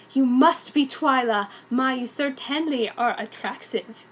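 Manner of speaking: ordinary delivery, nothing marked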